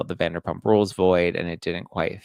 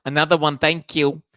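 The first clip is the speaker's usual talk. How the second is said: louder, proud announcer-style thank you; hammed-up triumphant thanks; 7 semitones higher, 8 dB louder than usual. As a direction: call it out like a victory lap.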